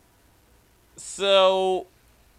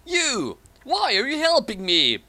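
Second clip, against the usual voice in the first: surprised; 9 semitones higher than usual. Acted. Hero confronts his rescuers.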